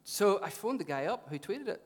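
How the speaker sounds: normal talking voice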